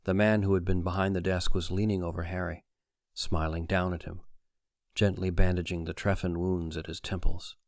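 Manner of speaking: usual speaking voice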